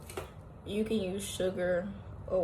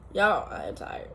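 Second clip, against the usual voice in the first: usual speaking voice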